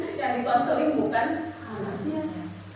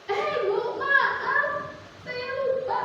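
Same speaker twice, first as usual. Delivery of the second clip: sheepish parent; 12 semitones higher, slower than usual. Retold or imitated. Parent excusing self to principal.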